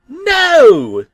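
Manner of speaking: very high, loud gleeful shout; happy yell. Acted. Bob answering the truck, with a very high gleeful yell. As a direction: yell it with delight. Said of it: "Bob yelled happily".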